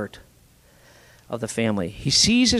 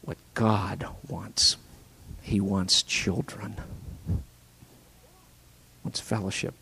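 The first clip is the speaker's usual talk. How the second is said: whisper; 8 semitones lower, 7 dB quieter than usual. Real, not acted. Whispered sermon point.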